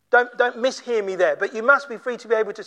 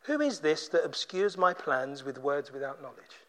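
low, hushed challenge from God; God's solemn rebuke; 7 semitones lower, 10 dB quieter than usual. Acted. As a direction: lower the voice and drop it very quiet. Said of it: "He says".

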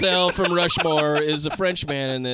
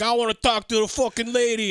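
put-on higher, belligerent drunk voice; drunken bully; 6 semitones higher than usual. Drunk douchebag interrupts the couple at the restaurant, drunken bully. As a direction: play a drunk heckler demanding attention. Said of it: "This guy is so drunk".

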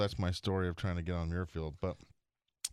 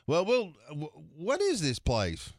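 high, slow, clueless drawl; Bobby's put-on innocence; 7 semitones higher, slower than usual. Buddy jerking the gentleman's chain, high clueless put-on voice. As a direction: play dumb, high and drawn out. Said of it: "he was just putting it on for this guy".

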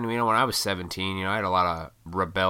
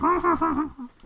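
high giggle; 4 dB louder than usual. Real, not acted. Laugh after mock voice.

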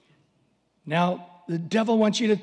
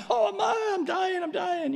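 much higher mock wail of a small kid; whiny child; 12 semitones higher than usual. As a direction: overdramatic toddler crying for mom. Child overdramatizing a scratched knee.

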